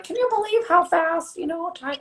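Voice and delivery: put-on high, creaky old-timer voice; old person voice. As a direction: sound like a fussy old person. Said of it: "that's my old person voice".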